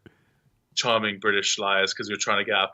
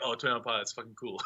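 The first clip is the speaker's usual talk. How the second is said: hushed mock approval, breaking into a laugh; quietly won over, amused; impressed reaction; 8 dB quieter, faster than usual. Listener once the band is famous, softly impressed and amused. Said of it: "Now it's like".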